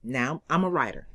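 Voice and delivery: ordinary delivery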